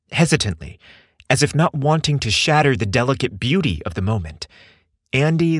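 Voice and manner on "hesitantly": ordinary delivery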